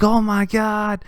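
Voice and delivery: high, slow, drawn-out gasp of shock; shocked crowd. Retold or imitated. The bar crowd reacting to the accusation, slow shocked. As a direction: drag it out in shock. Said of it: "everyone's like".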